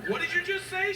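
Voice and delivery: high, loud alarmed question. Retold or imitated. Dad hearing daughter called mom, alarmed. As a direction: snap to attention.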